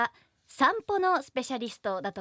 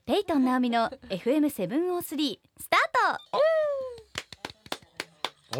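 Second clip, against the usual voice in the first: radio jingle call; 5 semitones higher than usual. Acted. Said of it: "かっこいい".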